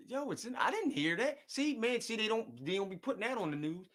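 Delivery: fired up that nobody told him; worked-up surprise. Real, not acted.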